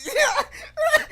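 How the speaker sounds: high laughing squeal of "Yeah". Retold or imitated. Reacting to funny face.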